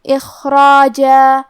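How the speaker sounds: اخراجا with a thick ra and a thin jeem, both long; heavy ra, light jeem. Shown as a demonstration. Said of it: "تو ہم یہ کلمہ کو ایسے پڑھیں گے".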